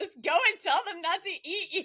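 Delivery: loud, high mock-exasperated mother; imitated mother. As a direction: imitate a mother sending kids off. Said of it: "she's like".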